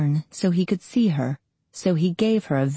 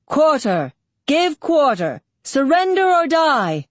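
raised, higher-pitched narrated war shout; battle cry; 9 semitones higher, 4 dB louder than usual. A narrator's reading. Commander calls quarter over the sounds of battle, shouting. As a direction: call it out across the din of battle. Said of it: "came a bass rumble still distinguishable as the words".

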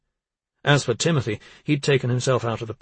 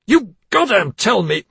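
furious demand; 9 semitones higher, 10 dB louder than usual. Acted.

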